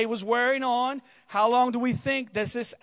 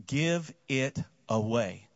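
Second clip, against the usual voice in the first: quiet solemn command; 10 semitones lower, 9 dB quieter, much slower than usual. Retold or imitated. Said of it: "he says these words".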